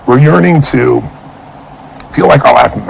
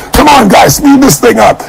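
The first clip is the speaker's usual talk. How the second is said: much higher, eager rallying call; frenzied; 9 semitones higher than usual. Retold or imitated. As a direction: hurry the crew along in a frenzy. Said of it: "washing these dishes with a frenzy".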